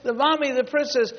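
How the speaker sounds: put-on high, loud squeal of a little girl; imitated child's scream. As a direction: cry out to Mommy, imitating an excited child. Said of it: "and she screamed".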